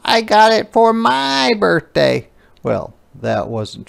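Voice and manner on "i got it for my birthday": high, loud claim; girl's fib. Acted. Ruthie loudly fibs to the teacher, high. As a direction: lie with conviction. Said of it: "she yelled".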